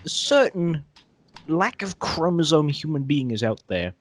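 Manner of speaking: slow dramatic trailer voice; old sci-fi announcer. Pretend. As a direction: intone it like an old movie trailer. Dramatic announcer-style intro.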